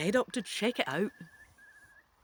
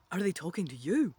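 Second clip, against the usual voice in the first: ordinary delivery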